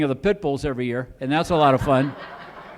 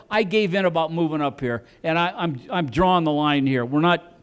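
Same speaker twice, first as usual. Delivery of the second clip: ordinary delivery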